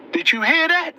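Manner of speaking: can you believe this, high and stunned; incredulous. Real, not acted. Host stunned by Trump clip, asks viewers, incredulous. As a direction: pitch jumps up in disbelief.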